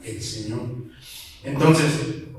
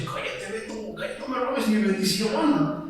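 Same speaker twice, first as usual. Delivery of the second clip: much softer, hushed and higher; a half-joking hush to an old friend; 4 semitones higher, 6 dB quieter than usual. Retold or imitated. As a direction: shush him gently so others won't hear. Hushing Beto who shouts blessings.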